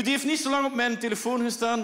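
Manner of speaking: ordinary talk, nothing marked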